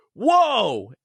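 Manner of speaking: high, loud startled whoa; replayed car-ride yell; retold yell. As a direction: shout it like the dunk just surprised you. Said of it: "And I yelled".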